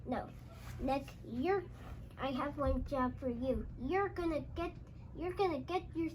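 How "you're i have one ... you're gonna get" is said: ordinary delivery, nothing marked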